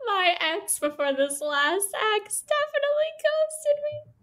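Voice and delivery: squeaky words through real laughter; laughing. Real, not acted. Cohost confessing her ex ghosted her, laughing. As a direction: laugh while admitting it.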